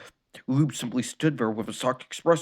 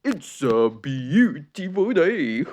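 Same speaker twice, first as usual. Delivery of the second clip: slow, drawn-out cheerful cartoon dog; sing-song cheerful proclaim; 4 semitones higher, 3 dB louder, much slower than usual. A narrator's reading. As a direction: stretch it out like a happy waking dog. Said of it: "cheerfully proclaiming".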